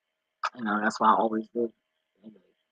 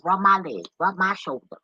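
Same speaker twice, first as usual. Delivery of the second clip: higher, demanding dog voice; pushy pup voice, pitched up; voicing the needy dog; 7 semitones higher than usual. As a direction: play the spoiled dog begging for rubs. Voicing demanding dog wanting rubs.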